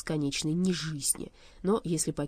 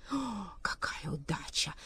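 slow, soft, whispered exclamation; breathless delight; 7 dB quieter, slower than usual. A narrator's reading. Overjoyed at lucky news.

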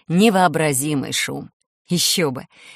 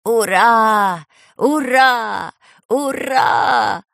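loud, higher cheering shout; joyful shout; 4 semitones higher, 6 dB louder, much slower than usual. Acted. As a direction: cheer at the top of your voice. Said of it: "радостно закричал".

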